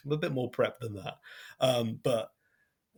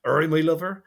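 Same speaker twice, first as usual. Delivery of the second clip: put-on loud, higher West Country Devon accent; broad Devonian accent; 5 semitones higher, 8 dB louder than usual. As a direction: lay on a broad Devon burr. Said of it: "very broad Devonian accent".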